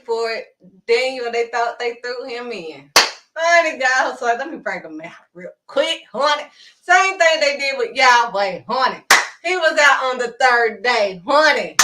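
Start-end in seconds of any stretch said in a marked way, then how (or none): 3.3-11.7 full-force preaching. Acted. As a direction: preach that God got them.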